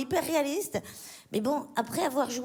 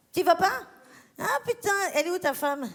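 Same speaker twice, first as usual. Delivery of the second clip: teasing outburst; 6 semitones higher, 3 dB louder than usual. Acted. Teasing Cédric for refusing.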